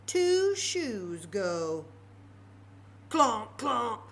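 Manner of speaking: slow build into loud clomp clomp; heavy clomping. A narrator's reading. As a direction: make the shoes stomp behind her. Spooky shoe sound.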